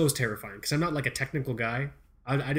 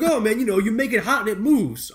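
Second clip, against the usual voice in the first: louder, higher-pitched clerk impression; hardware store guy; 8 semitones higher, 7 dB louder than usual. Store guy explaining the fix to a customer, emphatic. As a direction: imitate an eager clerk, pitched up and loud.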